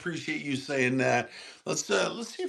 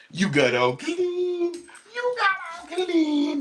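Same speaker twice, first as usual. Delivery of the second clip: high, slow cooing to the dog; slower than usual. Real, not acted. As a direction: stretch it out sweetly to the dog. Baby talk to dog.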